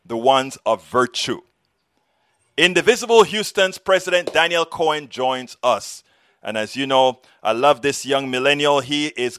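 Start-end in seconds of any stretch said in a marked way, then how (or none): none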